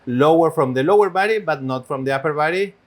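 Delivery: usual speaking voice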